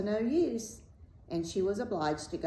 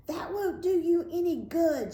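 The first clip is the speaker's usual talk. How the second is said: high, loud witch's cry; loud, threatening storybook witch; 9 semitones higher, 5 dB louder than usual. A narrator's reading. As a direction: shout it after them, pitched high. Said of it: "she cried".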